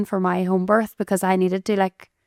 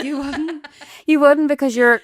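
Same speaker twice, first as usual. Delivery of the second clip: high, insistent no, you wouldn't; emphatic denial; 6 semitones higher, 3 dB louder than usual. Real, not acted. Friend reassures the other she wouldn't die, high and insistent. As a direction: reassure her she'd be fine, higher and firmer.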